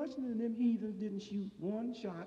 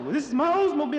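indignant mock shout; 9 semitones higher, 12 dB louder, faster than usual. Retold indignant reply.

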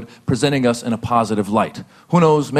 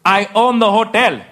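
Indian hotel owner; 8 semitones higher, slower than usual. Retold or imitated. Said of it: "He goes".

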